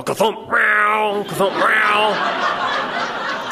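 slow thump, then long yowl; cat banging and meowing. Retold or imitated. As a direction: imitate a cat body-slamming a door and wailing. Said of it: "rock his body on the door and go".

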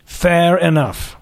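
put-on slow, lofty prep-school British accent; slow aristocratic accent. Boss questioning the candidate slowly in an aristocratic accent. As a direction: savour each word like a bored aristocrat. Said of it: "His accent was patently aristocratic".

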